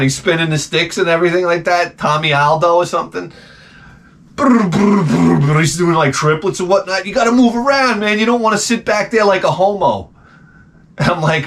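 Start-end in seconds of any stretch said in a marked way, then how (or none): 4.4-5.6 imitated drum roll. Comic imitating a drummer's triplets, trilled drum roll. 7.1-10.1 higher, hyped-up imitation of a drum instructor; coked-out drummer. Comic imitating a drummer's instruction video, coked-out hype. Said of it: "probably all coked out".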